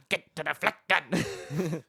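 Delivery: slow, put-on German announcer; German accent. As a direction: bark it like a war game announcer. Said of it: "that's German".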